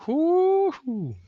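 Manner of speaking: high whooping cheer; excited cheer. Real, not acted. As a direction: whoop like the game's starting. Cheering as earnings call starts.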